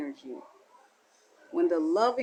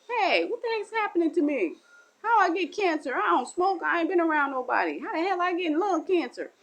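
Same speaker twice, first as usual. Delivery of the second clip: higher, bewildered complaint; shocked disbelief; 9 semitones higher than usual. Acted. A non-smoker hearing of cancer, higher and shocked. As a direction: protest the bad news in disbelief.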